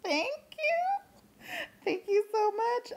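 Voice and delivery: tiny high embarrassed thank you; bashful thank you. Retold or imitated. Retelling her reply to compliments.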